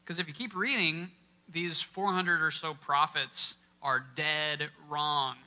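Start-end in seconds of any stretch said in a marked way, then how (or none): 1.5-5.4 "dead wrong" said slowly, with "dead" hit hard; stress on dead. Shown as a demonstration. Preacher stressing "dead" to the congregation, slow and weighty. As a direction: lean on "dead" and stretch out the phrase. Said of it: "with an emphasis on the word dead".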